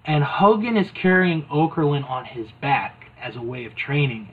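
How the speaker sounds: ordinary talk, nothing marked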